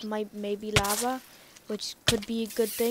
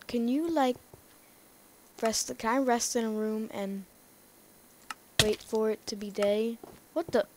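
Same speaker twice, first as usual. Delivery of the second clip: ordinary talk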